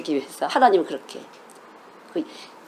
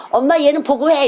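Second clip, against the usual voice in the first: put-on louder-edged, higher child's voice telling on a sibling; tattling child; 5 semitones higher, 4 dB louder than usual. Children reciting verses, one tells mom on another, tattling. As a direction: imitate a kid protesting to mom. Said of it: "이러는 거죠".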